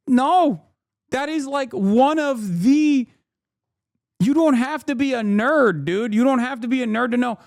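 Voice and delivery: high, loud exasperated rant. Real, not acted. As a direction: push it up in frustration. Heated rant about the symbol.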